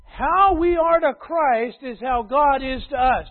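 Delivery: loud, high, rhythmic pulpit cadence; full-voiced preaching refrain; preaching at full force. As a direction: drive each line home to the church. Summing up the point.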